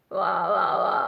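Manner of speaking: low croaking rasp; croaking fry. Shown as a demonstration. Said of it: "just croaking along".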